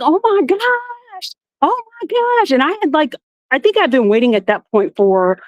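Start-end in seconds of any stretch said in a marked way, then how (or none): 0.0-2.5 high, slow, drawn-out gasp of shock; stunned disbelief. Retold or imitated. Retelling her registration panic, stunned and high. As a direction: let the shock stretch each word. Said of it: "And I was like".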